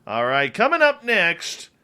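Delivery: upbeat announcer read over a music bed; radio announcer. Acted. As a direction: hype the next guest like a radio promo. Announces next segment over music.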